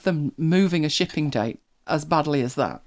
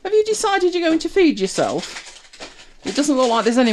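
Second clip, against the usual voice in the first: high sing-song voice for the dog; pet talk; 11 semitones higher, 4 dB louder than usual. Real, not acted. Greeting the dog.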